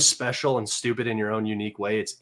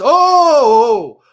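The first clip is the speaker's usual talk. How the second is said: a sudden high, loud "oh" on spotting him; loud surprised oh; 10 semitones higher, 13 dB louder than usual. Real, not acted. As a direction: yelp of surprise. Chatter's reply appears.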